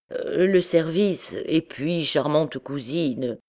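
usual speaking voice

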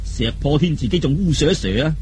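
plain reading voice

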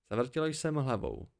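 ordinary delivery, nothing marked

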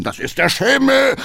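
high, loud shout over the gallop; calling out. A narrator's reading. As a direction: coachman shouting back in excuse. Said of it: "rief Hackendahl". (laut rufend)

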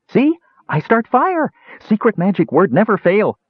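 high-pitched gloating dwarf; triumphant boast. A narrator's reading. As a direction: crow proudly over the magic. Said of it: "Bupu shouted in triumph".

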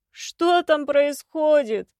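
shouting. A narrator's reading. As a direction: call out from behind the door. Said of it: "крикнул из-за двери ушастый".

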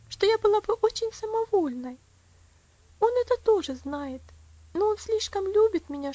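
put-on high, loud, emphatic child's voice; earnest little girl. Elsie telling Rosa how she loves papa, fervently.

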